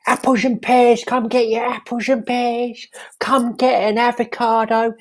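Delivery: Cockney stallholder cries his wares; market trader's call. Acted. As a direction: hawk your fruit to passers-by in Cockney. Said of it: "Used to be down market".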